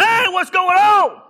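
loud, slow, high startled call; alarmed shout. Retold or imitated. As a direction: break up the scene with a shout. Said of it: "I'm like".

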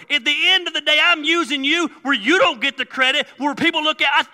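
high, loud, shouted sermon; full-force preaching. Acted. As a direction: preach it at a shout, driving and insistent.

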